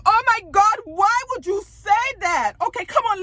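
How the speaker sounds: high, loud, scandalized outburst; mock exasperation. Vlogger acting out a castmate's shocked reaction, high mock exasperation. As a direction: exclaim it up high like an appalled friend.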